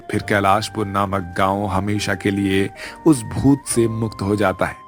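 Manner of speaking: ordinary delivery, nothing marked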